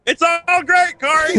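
very high, strained comic voice; put-on silly voice. Co-host reassures his partner about an aging musician, put-on high voice. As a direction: squeal it at your co-host.

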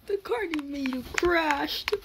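ordinary talk

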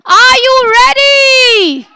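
high, loud, slow drawn-out call; hype shout. Acted. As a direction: stretch it out to fire up the crowd. Host pumping up the audience.

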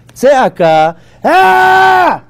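Tzeaka then a sudden high loud ah; demo shout. Shown as a demonstration. Teacher demonstrating tzeaka to class with a loud high shout. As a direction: belt out Tzeaka and cry ah like a real outcry. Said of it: "two different words between tzeaka and zeaka".

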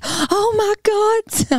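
high, slow, drawn-out mock astonishment; shocked gasp. Vet retelling the owner's shock at the news, high slow mock gasp. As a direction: stretch it out like a jaw-dropped owner.

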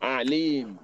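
Alim starting on a throat-squeezed Arabic Ain. Shown as a demonstration. Teacher repeating for learners to copy, Alim with Ain. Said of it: "Ain with Hamza".

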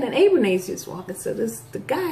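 ordinary talk

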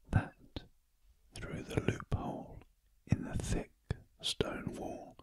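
plain reading voice

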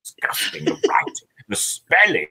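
hushed start, rising on "spelling"; hammed-up distaste building from a hush; mock disgust. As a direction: imitate an appalled publisher, half under the breath. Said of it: "'cause they thought".

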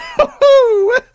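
high shrieking burst of laughter. Real, not acted. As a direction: cackle it out high and loud. Huge laugh.

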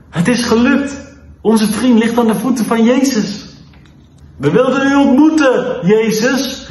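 high-pitched, excited call from a story. A narrator's reading. Levi calls down to Jesus from the roof, excited. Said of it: "riep Levi".

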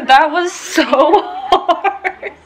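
breathless laughing words. Real, not acted. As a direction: laugh through it, still winded. Laughing after the hard class.

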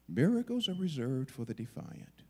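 ordinary talk, nothing marked

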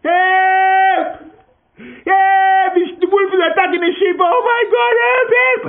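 very high, loud desperate screaming; yelling for help. Acted. Boy screaming at the village a third time, desperate shout. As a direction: scream for help in real terror. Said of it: "he yelled down".

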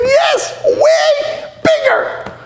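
very high, drawn-out mock shriek; hammed-up hysterical squeal; panicked wife. Wife reporting a giant creature to her husband, mock panicked shriek.

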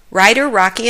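ordinary delivery, nothing marked

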